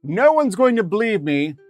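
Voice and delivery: high, loud, insistent claim; excited exclamation. Real, not acted. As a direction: burst out with it, pitched well up. Excited claim of having foreseen it.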